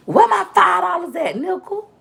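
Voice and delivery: high-pitched mock demand; playful confrontation. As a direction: shake him down in a high, hammed-up voice. Acts out confronting him for money.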